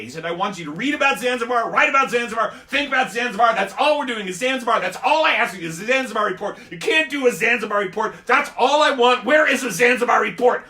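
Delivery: ranting teacher. Retold or imitated. Teacher to the pupil, a loud exasperated rant. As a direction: hammer the word Zanzibar in frustration.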